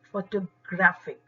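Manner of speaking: Photographic with a loud, punched GRAF; photographic said as fo-tuh-GRAF-ik; stress on third syllable. Shown as a demonstration. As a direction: hit the ग्रा of photographic hard. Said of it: "ग्र पर stress ज्यादा है".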